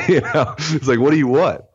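high, laughing through the words; giggly rhetorical question. Real, not acted. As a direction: chuckle it out, amused. Laughing at his own joke.

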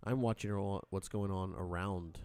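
ordinary talk

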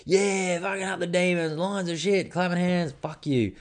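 high mocking yobbo jeer, Demons fans rubbing it in; rowdy fan. Mocking the Demon supporters beside him, high gloating jeers.

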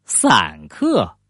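散客 said slowly with s in place of sh; childish mispronunciation. A narrator's reading. Little sister mangles the nickname, 散客 said slowly with plain s. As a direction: draw out 散客, a small child's lisped 闪克. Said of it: "但她叫不清楚".

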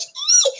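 very high, loud squeal of joy; excited squeal. A narrator's reading. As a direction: squeal with delight at dinner. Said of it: "I was squealing".

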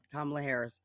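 normal talking voice

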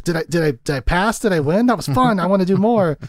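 put-on high, eager kid-like voice; bubbling mock excitement; giddy, childlike. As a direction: imitate a child begging for another go. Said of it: "he's so giddy and childlike".

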